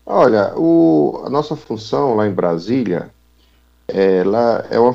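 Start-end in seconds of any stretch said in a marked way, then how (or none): none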